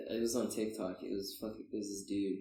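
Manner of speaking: ordinary delivery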